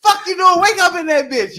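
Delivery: high, loud yell broken by laughter; cracking up while yelling at him; laughing incredulous shout; laughing outrage. Retold or imitated.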